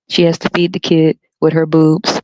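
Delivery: normal talking voice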